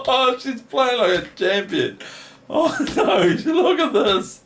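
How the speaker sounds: high, laughing praise. Real, not acted. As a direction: praise her through giggles. Laughing at a winning attack.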